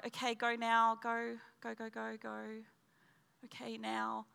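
under-the-breath prompting, very soft; small, hushed repeated urging, fading away; hushed self-urging. Retold or imitated. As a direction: quietly nudge yourself along. Retold urging herself under breath.

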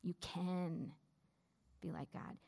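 "can" stressed, "be like God" trailing off soft; the serpent leaning on "can". Shown as a demonstration. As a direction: push the word "can", then fade. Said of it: "emphasizing different words each time".

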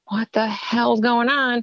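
a drawn-out, startled what-the-hell; alarmed disbelief. Retold or imitated. Alarmed at technician's tears.

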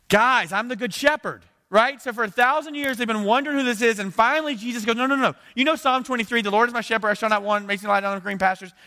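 high, loud preaching at full force; booming proclamation, pitched high and driven hard; impassioned preaching. Acted. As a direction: shout it out like a fired-up preacher.

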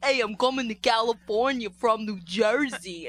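loud, slow, hammed-up New Jersey accent; mock a terrible Jersey accent at full volume; Joseph Gordon-Levitt. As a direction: overblown New Jersey caricature, dragged out. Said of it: "talking like that".

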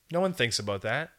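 ordinary talk

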